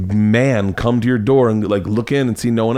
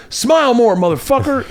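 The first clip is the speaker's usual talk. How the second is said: mock crude catcall; 13 semitones higher than usual. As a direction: bark it like a cartoon street creep. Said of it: "And go".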